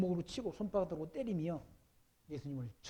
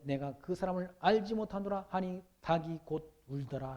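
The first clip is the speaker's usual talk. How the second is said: loud, higher, slow preached reading; scripture reading; 3 semitones higher, 5 dB louder, slower than usual. Acted. Pastor reads Peter's denial to the congregation, proclaiming loudly. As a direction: proclaim the verses to the whole hall.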